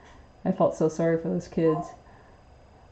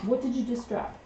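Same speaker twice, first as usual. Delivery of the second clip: higher, playful pet-talk to a parrot; talking to pet bird; 6 semitones higher, 5 dB quieter than usual. Real, not acted. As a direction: owner teasing her parrot for dropping food.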